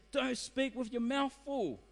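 retold parental telling-off, pitched well up. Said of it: "I raised my voice".

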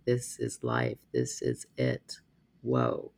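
hollow low murmur of resignation; despairing inner voice. Retold or imitated. Inner despairing thought, dark mood.

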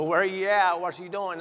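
loud, high mock confrontation; mock slinging. Pastor imitating how not to greet absent member, loud and confrontational. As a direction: demand it loudly, imitating a pushy pastor. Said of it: "he don't come out s-, slinging".

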